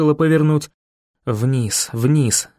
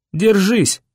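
higher, urgent cry to hold on; will her to hang on; urgent urging; 5 semitones higher than usual. A narrator's reading. Boy running with Viola in his arms, urgent urging.

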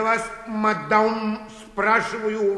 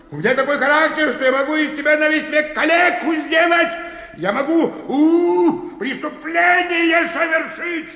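loud, higher, fast raging threat; angry threat; 7 semitones higher, 5 dB louder than usual. Acted. Clerk raging at the old woman, threatening to cripple her. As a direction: roar at her that you'll maim her.

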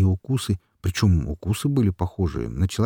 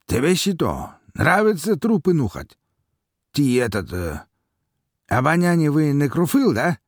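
teasing; 9 semitones higher, 3 dB louder than usual. A narrator's reading. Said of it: "подкалывал своего товарища".

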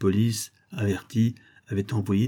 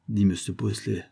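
ordinary delivery, nothing marked

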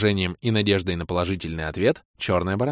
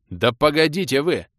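exasperated outburst; 6 semitones higher than usual. Acted. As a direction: burst out, fed up. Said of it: "взорвался я".

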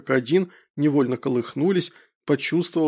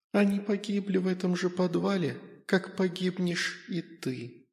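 тихий потусторонний голос мальчика-призрака, медленно затихает; говори тихо, как призрак в голове; 4 semitones higher, 7 dB quieter than usual. A narrator's reading. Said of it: "Долетел до меня последний ответ". (тихий голос призрака)

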